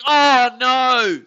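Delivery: loud, high alarmed oh no; dismayed cry. Real, not acted. Fan watching a hit, loud dismayed cry. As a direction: shout at the screen in alarm. Play going wrong on stream.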